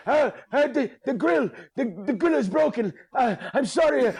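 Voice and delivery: high, stammering scared voice; mock terrified apology; imitating scared stammer. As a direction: stutter it out like a frightened clerk. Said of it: "he's scared".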